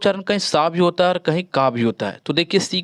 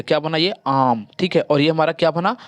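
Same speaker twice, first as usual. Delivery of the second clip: ordinary talk, nothing marked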